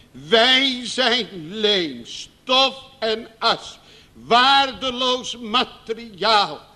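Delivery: "Wij zijn leem, stof en as" stretched slowly; very slow solemn. Acted. Preacher to congregation, very slow solemn. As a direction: drag each phrase out. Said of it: "preacher slow emphasis".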